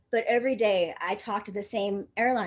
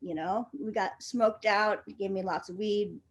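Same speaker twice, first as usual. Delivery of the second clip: ordinary delivery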